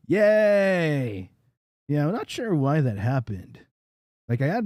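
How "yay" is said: loud high yay; happy cheer. Real, not acted. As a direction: cheer with relief. Sound finally fixed.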